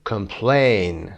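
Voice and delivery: "Complain" with PLAIN drawn out; quick COM, then hold the PLAIN in "Complain"; com-PLAIN, slow with weight on the end; stress on second syllable. Shown as a demonstration. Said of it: "به این صورت".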